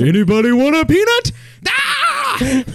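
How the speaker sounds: hammed-up giant's joke; Fezzik impression. Fezzik quote ending laugh.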